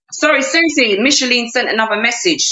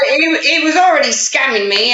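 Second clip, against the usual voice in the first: ordinary talk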